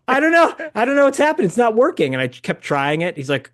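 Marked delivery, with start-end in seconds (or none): 0.1-2.1 high, loud flustered excuse; panicked fluster. Retold or imitated. Fan panicking when the camera fails. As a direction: sputter in panic.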